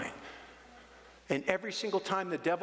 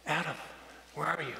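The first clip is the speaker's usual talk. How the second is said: lightly voiced tender God calling Adam; gentle calling; 5 dB quieter, faster than usual. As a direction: call him like a loving father looking for his child. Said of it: "This is how he said it".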